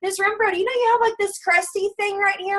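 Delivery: high-pitched imitation of a curious third grader; pupil. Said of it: "everyday conversation in my classroom".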